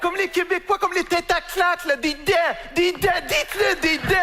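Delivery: high, strident, insistent voice; mocking Parisian demand. Parisian correcting the Québécois on "des dents", high and insistent. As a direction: badger them in a high exaggerated voice. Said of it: "c'est les arriérés mentaux qui font comme ça".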